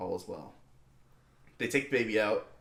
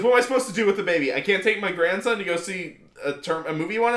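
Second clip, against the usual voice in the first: fast, higher grandma complaint; imitating the grandmother; 6 semitones higher, faster than usual. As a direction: rush the grandma's excuse. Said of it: "she goes".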